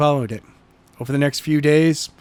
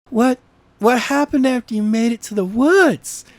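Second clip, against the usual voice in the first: put-on higher, curious girl's voice; eager question; 9 semitones higher than usual. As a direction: ask it leaning in, eager. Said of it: "asked Jenna".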